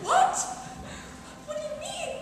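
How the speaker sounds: high-pitched what, caught off guard; shocked. Retold or imitated. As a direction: play the startled lunch lady, voice jumping way up. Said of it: "this woman was like".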